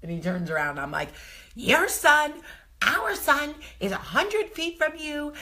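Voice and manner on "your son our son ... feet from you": high, loud yell at him; retold scream. Wife yelling at husband over the waves, high loud accusation. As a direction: shriek it across the beach, accusing. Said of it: "don't scream at me".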